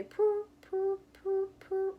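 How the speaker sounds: high, loud cooing purr purr; playful high pigeon coo for children. Retold or imitated.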